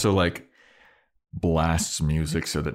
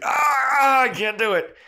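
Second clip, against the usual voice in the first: high strained cry of frustration; mock frustrated high yelp; frustrated Spike; 5 dB louder, slower than usual. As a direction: whine it up high, can't manage.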